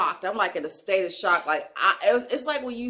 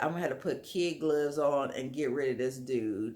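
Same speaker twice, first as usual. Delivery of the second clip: quiet inner thought; 7 semitones lower, 8 dB quieter than usual. Retold or imitated. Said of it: "I'm thinking to myself".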